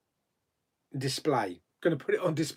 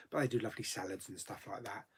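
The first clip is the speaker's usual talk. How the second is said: ordinary talk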